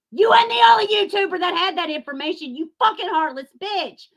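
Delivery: furious shout. Real, not acted. Accusing the YouTuber of exploiting the family, shouting. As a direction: yell the accusation at her.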